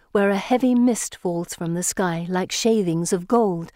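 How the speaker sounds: plain reading voice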